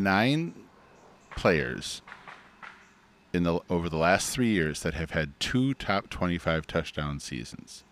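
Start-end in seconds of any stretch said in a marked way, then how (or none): none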